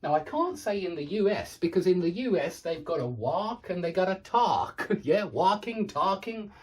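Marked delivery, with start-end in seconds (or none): 3.1-4.9 "walk" and "talk" with an open ah; American vowel attempt. Shown as a demonstration. Teacher guessing at US speech, "walk" and "talk" with ah. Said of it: "say it how I think they say it". 5.2-6.5 "walking, talking" with an open ah; American vowel attempt. Shown as a demonstration. Teacher imitating imagined US speech, "walking, talking" with ah. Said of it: "say it how I think they say it".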